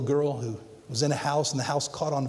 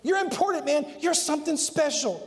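much higher, gushing praise; flattering admirer; 8 semitones higher than usual. Retold or imitated. Preacher voicing admirers, a much higher gushing flattery. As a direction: pump up his ego like a fan. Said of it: "people look at us and say".

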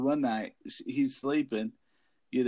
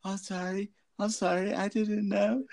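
higher, panicky sorry; mock frightened apology; 8 semitones higher than usual. Guy apologizing to grandma ghost, mock frightened. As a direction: apologize like you're freaking out. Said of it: "He's all".

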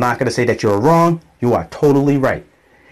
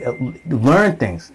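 normal talking voice

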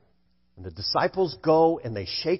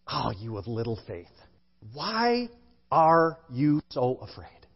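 hushed, slow and measured question; low, soft opening, deliberate pauses between phrases; softly acted rebuke; 7 semitones lower, 6 dB quieter than usual. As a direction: voice Jesus gently questioning his frightened followers. Voicing Jesus, inviting imagined scene.

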